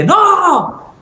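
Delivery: sudden very high yelled no; shouted no. Retold or imitated. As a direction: yell it at the phone. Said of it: "le grité a esa señora". (grito agudo: ¡No!)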